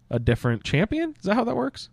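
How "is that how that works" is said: ordinary talk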